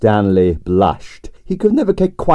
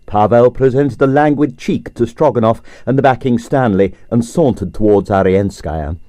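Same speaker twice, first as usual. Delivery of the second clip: ordinary delivery, nothing marked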